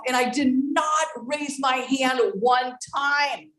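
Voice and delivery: ordinary talk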